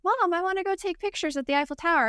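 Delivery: lightly voiced daughter asking mom for something; teen daughter voice. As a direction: play the eager daughter. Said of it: "she mentioned one day".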